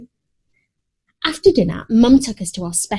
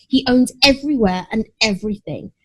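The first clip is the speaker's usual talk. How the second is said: plain reading voice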